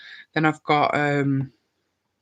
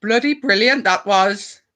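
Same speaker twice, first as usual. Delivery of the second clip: higher Welsh lilt on "Bloody brilliant"; Welsh accent; 5 semitones higher, slower than usual. Pretend. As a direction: another sing-song Welsh try. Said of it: "a good Welsh accent".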